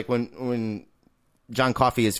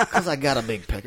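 laughing quote. Retold or imitated. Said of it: "he says it under his breath".

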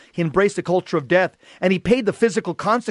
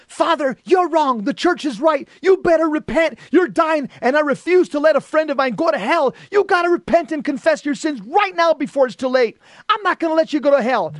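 high, urgent retold yell; 8 semitones higher than usual. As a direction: plead and warn in a raised, high-pitched voice. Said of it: "and I yelled at him".

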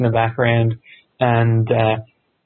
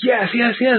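much higher, delighted imitation of the old wrestler; match the fan's excitement; gleeful yeses from a thrilled veteran; Kobashi's excited yes; 10 semitones higher than usual. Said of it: "and then he's like".

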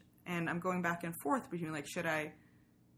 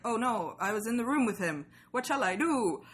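breathless mock panic, a touch louder; hammed-up swooning dismay; mock flustered heroine; 4 semitones higher, 6 dB louder than usual. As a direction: play the flustered Regency heroine.

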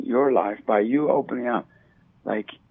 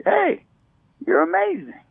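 1 bright high greeting. Retold or imitated. Acted greeting to stranger.

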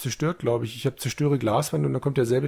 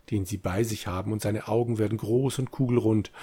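ordinary talk

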